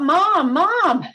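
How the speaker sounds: put-on high, loud child's call for Mom. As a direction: call across the room for help. Kids calling for mother.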